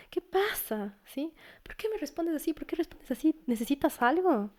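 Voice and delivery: caring parent. Retold or imitated. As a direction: coax the child to open up. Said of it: "en lugar de castigar".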